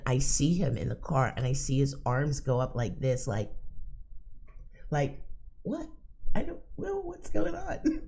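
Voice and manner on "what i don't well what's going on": mock bewildered driver; imitating confused driver. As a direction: play the baffled man in the car.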